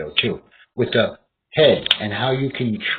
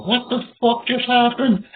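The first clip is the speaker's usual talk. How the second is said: high, baffled "What the fuck just happened?"; mock-startled high pitch; heart shocked; 11 semitones higher than usual. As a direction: voice the heart as if it can't believe it. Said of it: "your heart's gonna go".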